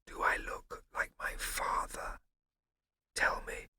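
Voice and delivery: soft breathy whisper; whispered very quietly. A narrator's reading. Scipio whispering to Prosper, very quietly. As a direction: barely whisper his name.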